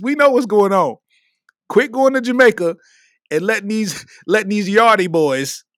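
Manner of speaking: usual speaking voice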